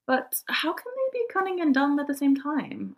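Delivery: put-on childlike curious crow voice; young crow. A narrator's reading. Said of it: "asked a young crow".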